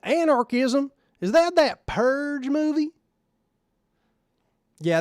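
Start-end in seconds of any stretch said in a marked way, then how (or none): 0.0-2.9 high, loud, slow baffled Texan drawl; dim Texan lawman. Pretend. Streamer mocking a normal person asked about anarchism, baffled Texan drawl. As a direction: play a clueless Texas cop hearing a big word. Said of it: "sounded like the cop from No Country for Old Men".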